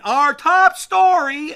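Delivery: drawn-out, high, full-volume yell; slow high-pitched shout, every word stretched; imitated yell. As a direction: bellow the headline slowly, as if for the hard of hearing. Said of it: "Garrett Morris would just yell it".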